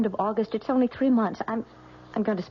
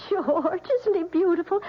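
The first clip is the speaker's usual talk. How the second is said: high, delighted new mother; joyful; 10 semitones higher than usual. Acted. New mother shows husband their baby, high joyful gush. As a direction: gush over the newborn.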